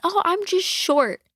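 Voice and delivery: high, slow, innocent excuse; cutesy fib in a high child's voice; little girl voice. Retold or imitated. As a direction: squeak it out like a tiny kid. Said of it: "she just goes by like".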